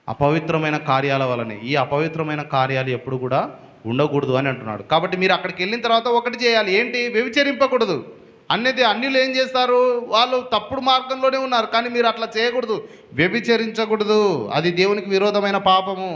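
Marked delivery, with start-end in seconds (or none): 4.8-16.1 higher, urgent sermon voice; preacher warning the flock not to sin; preaching at full force. Acted. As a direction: exhort the congregation. Preaching the commandment.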